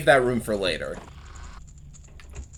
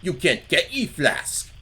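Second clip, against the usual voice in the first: slow, higher-pitched cartoon character quote; Strong Bad; 5 semitones higher, slower than usual. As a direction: put on a drawn-out, higher mock adventure-game voice. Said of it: "an episode of Strong Bad".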